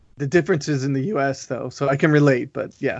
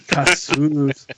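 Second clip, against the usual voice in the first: Cassous in an American accent. Shown as a demonstration. Host repeating his favorite word at sign-off, American accent. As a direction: say Cassous with American vowels and plain final s. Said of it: "Is that a total American accent".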